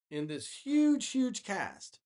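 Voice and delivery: huge said high and loud; stress on huge. Real, not acted. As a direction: lean on huge twice.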